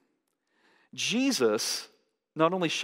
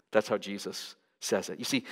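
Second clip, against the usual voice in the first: usual speaking voice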